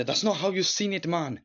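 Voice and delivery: acted-out indignant correction; mock telling-off. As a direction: scold him as the film character. Quoting characters who slap him.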